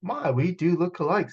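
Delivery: a sly snake noticing the likeness. Acted. Snake talks to Dom, lightly voiced. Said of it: "said the snake".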